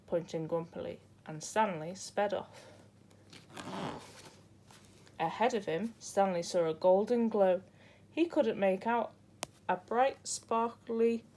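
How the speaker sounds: plain reading voice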